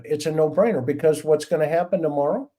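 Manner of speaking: usual speaking voice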